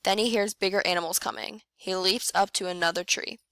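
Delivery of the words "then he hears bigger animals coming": ordinary delivery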